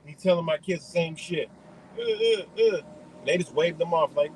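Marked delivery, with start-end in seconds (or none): 2.0-2.8 high mocking uh uh uh, much higher; mocking dismissive grunts. Kids brushing off the boat guy with high mocking grunts.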